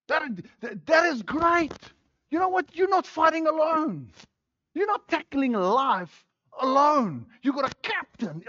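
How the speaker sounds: high, loud excited preaching. Real, not acted. Preacher telling the church they have a captain, excited. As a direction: exclaim it with real excitement. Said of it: "I'm excited about it".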